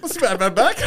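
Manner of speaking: high, loud comic impression; imitating movie slang. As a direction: toss it back, high and hammed-up. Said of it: "And they're like".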